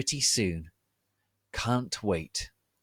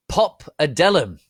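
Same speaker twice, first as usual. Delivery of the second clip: higher, louder hurled spell; angry spell; 8 semitones higher, 12 dB louder than usual. Acted. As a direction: fling the curse at them in anger. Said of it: "I yielded, angrily".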